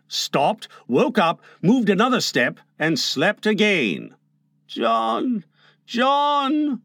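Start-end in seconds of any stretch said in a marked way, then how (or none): 4.7-6.8 higher, drawn-out call for John; crying out. A narrator's reading. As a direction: call out to rouse a sleeping brother. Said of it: "Michael would cry".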